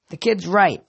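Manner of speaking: plain reading voice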